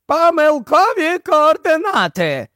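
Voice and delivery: high-pitched professor's call; calling over shoulder. A narrator's reading. Professor calls back to Barney over the surf, high-pitched shout. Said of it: "кинув через плече професор".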